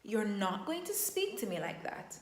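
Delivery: calm green state; calm firm reply. Shown as a demonstration. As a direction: answer the yelling with calm. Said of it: "put on my crown".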